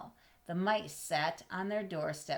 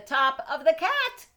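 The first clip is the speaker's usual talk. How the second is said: high, loud and slow, landing on cat; excited leap; 10 semitones higher, 9 dB louder, slower than usual. Acted. Reading to a child, punching the rabbit's jump onto the cat. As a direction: make the jump land with a bang.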